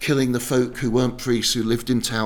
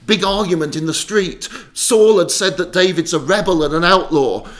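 loud, high, agitated storytelling; agitated narration; 7 semitones higher, 10 dB louder than usual. A narrator's reading. Telling of argument in the street, agitated narration. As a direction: tell the uproar at full volume. Mayhem retold breathlessly.

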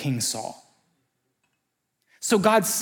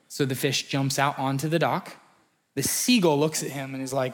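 normal talking voice

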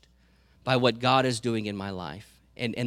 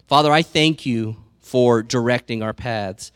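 louder, earnest prayer voice; 5 dB louder than usual. Real, not acted. Pastor thanking God for his family, praying aloud. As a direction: pray it out with thanks.

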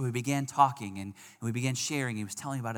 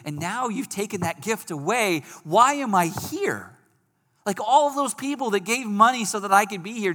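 anguished prayer; 7 semitones higher, 5 dB louder than usual. Retold or imitated. Crying out to God from bed.

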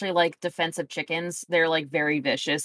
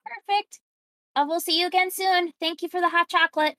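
higher, friendly shopkeeper asking for help; a cheerful merchant asking the customer a favour; 11 semitones higher than usual. Acted. Merchant Stacy asks Sally to carry crates, higher shopkeeper voice.

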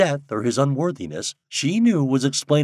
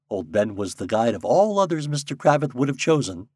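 plain reading voice

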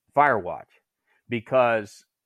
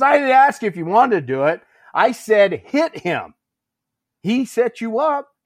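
loud, high drill-instructor yelling; retold angry insistence; 10 semitones higher, 7 dB louder than usual. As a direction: imitate a sergeant losing patience. Senior insists on his order.

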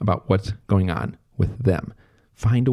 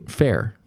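"Fair?" echoed as a gentle question; one-word question. Shown as a demonstration. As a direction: hand "Fair?" back to invite more. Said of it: "a one-word question".

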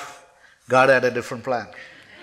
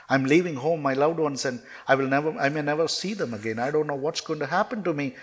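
ordinary talk

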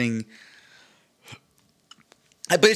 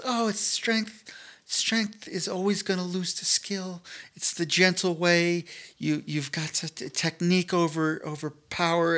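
judo instructor voice; 5 semitones higher, 4 dB quieter than usual. Retold or imitated. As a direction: preach it like a wise sensei. Instructors quoted preaching judo skill.

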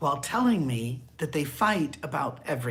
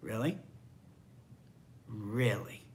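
lightly voiced, low and soft rebuke; a quiet knowing reproach; God's rebuke; 5 semitones lower, 8 dB quieter than usual. Preacher imitates God gently rebuking him, low and soft. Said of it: "see God looking at me going".

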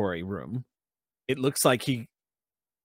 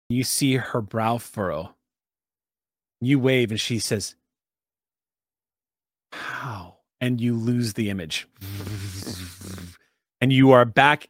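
game master narration. Acted. Game master describing the vision to the players, immersive narration. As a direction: paint the scene for the table. GM sets the scene.

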